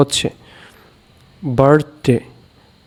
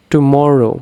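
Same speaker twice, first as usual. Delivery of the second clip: Tomorrow with weight on মর; stress on middle syllable; much slower than usual. Shown as a demonstration. Teacher demonstrating stressed syllables, tomorrow stressed on মর. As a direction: lean on মর in tomorrow. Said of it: "এটি হচ্ছে একটি stressed".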